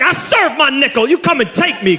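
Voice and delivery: a light mock of a hardened convict; tough ex-con. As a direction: swagger like a guy fresh out of jail. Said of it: "that confidence".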